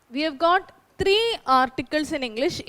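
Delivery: usual speaking voice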